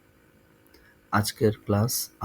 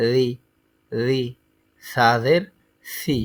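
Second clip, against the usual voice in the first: recitation drill; slower than usual. Acted. A teacher drilling a class in letter sounds, chanting. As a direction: call out each letter so the class can repeat.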